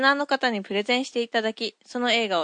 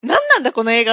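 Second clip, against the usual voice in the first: exasperated outburst; 9 dB louder, faster than usual. Retold or imitated. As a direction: blurt it out, baffled. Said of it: "なんとも言えないんですよ".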